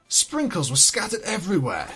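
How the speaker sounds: ordinary delivery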